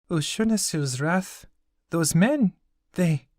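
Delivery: voiced high like a young girl, gentle; high girl's voice. Girl reassures the grieving craftsman, high and gentle. Okizi's sorrow rising.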